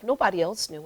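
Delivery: normal talking voice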